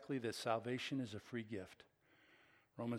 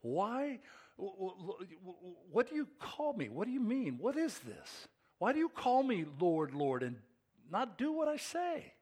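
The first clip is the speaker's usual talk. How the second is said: higher, stammering disbelief; incredulous Jesus; 6 semitones higher than usual. Retold or imitated. Pastor voices Jesus asking why they call him Lord, higher and disbelieving. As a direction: sound like you can't believe it. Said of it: "That's the tone of his words".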